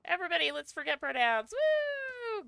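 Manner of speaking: loud high whoop; excited cheer. Real, not acted. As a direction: shout it out, cheering. Cheering to the group.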